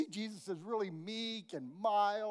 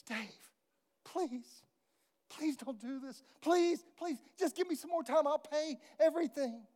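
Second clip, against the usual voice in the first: pleading; 8 semitones higher than usual. Acted. Tom begging Dave.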